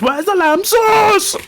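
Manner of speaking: high, drawn-out mock chef yell; hammed-up catchphrase shout; Gordon Ramsay. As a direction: stretch it into an outraged kitchen cry. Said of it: "the episode where he goes".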